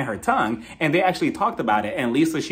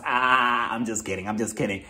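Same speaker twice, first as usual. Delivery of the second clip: low, softened mock backpedal; Erica; 6 semitones lower, 4 dB quieter than usual. Imitating her brushing off her own scheme, joking. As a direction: drop low and quieter for the joke. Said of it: "She told Kyle".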